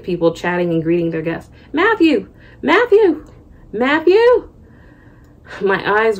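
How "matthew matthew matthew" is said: high-pitched call across a crowd; yelling a name. A narrator's reading. Host father calling his guest at the airport, yelling his name. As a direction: call out as if hailing someone far away. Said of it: "who was yelling my name".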